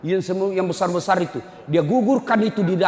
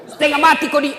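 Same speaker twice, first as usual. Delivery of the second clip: higher, louder mock retort; scolding wife; 11 semitones higher, 8 dB louder than usual. Wife snapping at her husband, higher and louder. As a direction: snap it back at him. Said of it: "Langsung datang mamanya".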